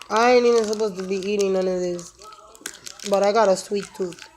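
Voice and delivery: talking through a mouthful of candy; words round a bite of chocolate; chewing while talking. Real, not acted. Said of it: "I apologize, I'm chewing".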